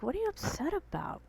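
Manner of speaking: high, mock-innocent question to dad; playing ignorant. To angry dad, faking innocence, high voice. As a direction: ask it sweetly, pretending not to know. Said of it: "I played ignorant".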